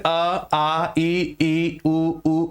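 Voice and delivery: अ, आ, ई, ई, उ, उ each sounded twice, clearly; vowel demo. Shown as a demonstration. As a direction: pronounce each vowel twice, slowly and cleanly. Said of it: "आप इनका उच्चारण कीजिए".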